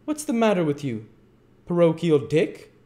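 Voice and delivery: high, loud, mock-hearty question; jocular beadle. A narrator's reading. Bumble joking at the trembling child, jocular. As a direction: tease the child with pompous good humour. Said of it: "inquired Mister Bumble with well-timed jocularity".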